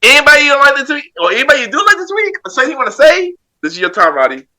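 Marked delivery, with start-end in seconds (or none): 0.0-3.3 hyped. Real, not acted. Host hypes up Roddy.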